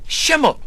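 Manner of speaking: Schimmel said high, relishing the German SH; savouring the word. Shown as a demonstration. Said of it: "Nice word, that".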